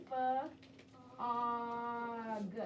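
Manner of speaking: Fa, then og, split into separate sounds; sounding out fog. Shown as a demonstration. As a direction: stretch the word apart, sound by sound. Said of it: "write the sounds we hear in fog".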